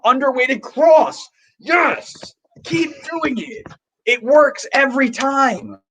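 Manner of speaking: screaming at the screen. Real, not acted. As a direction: scream it like the coach on the sideline. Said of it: "and you're still screaming".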